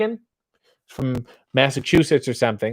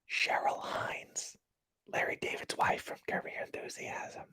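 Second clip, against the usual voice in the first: whispering; 2 semitones lower, 18 dB quieter than usual. Real, not acted. Whispering gossip to the chat about a celebrity follower. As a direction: whisper it like a secret name drop. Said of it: "the whispering is kinda sexy".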